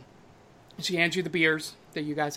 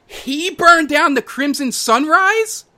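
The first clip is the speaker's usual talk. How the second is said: higher, louder, slow shocked disbelief; shocked question; 11 semitones higher, 8 dB louder, slower than usual. Acted. Barkeep Annie asks Tribeca, shocked and loud. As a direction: gasp it out in disbelief. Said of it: "to Tribeca and goes".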